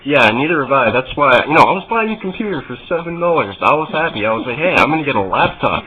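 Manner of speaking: ordinary talk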